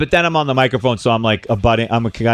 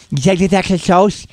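lisping manager, higher voice; slushy lisp; 6 semitones higher than usual. Retold or imitated. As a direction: lateral lisp, higher pitched. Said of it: "he had a lateral lisp".

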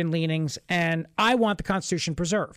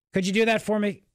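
ordinary talk

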